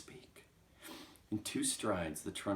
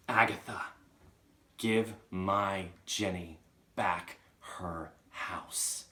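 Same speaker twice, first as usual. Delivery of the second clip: slow, louder, word-by-word message; ominous command; 6 dB louder, slower than usual. Acted. Chalk commanding Agatha about Jenny's house, slow and ominous. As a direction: order it like a ghost, with heavy gaps.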